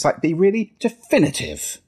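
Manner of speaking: "definitive" starts high and drops firmly; definitive falling ending. Shown as a demonstration. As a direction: land "definitive" with a decisive downward fall. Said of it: "going down at the end of a sentence".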